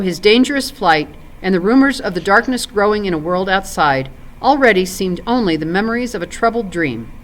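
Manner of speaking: usual speaking voice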